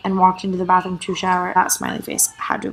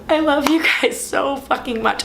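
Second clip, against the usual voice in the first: tearful, high and slow; choking up on the love; 6 semitones higher than usual. Real, not acted. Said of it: "I'm trying not to cry".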